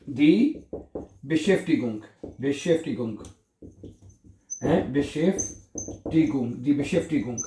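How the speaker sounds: slow, stretched Beschäftigung; slow model word. Shown as a demonstration. Teacher slowly modelling Beschäftigung for the learners. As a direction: draw Beschäftigung out slowly and clearly. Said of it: "क्या कहेंगे?".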